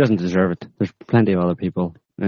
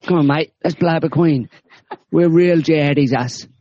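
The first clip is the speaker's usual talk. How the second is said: mock cockney jihadi; 9 semitones higher than usual. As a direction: ham up a cocky East End lad, much higher. Mocking British jihadi plotters.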